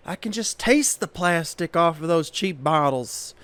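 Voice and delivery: a fussy diva asking for the manager; hammed-up entitled diva, high-pitched; total diva. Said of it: "a total diva".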